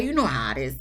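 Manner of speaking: normal talking voice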